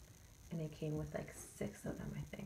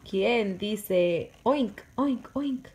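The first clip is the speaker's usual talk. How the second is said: loud, slow oink question; reading to baby; 3 semitones higher, 10 dB louder, much slower than usual. Shown as a demonstration. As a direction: stretch the oinks for the baby. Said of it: "reading to baby".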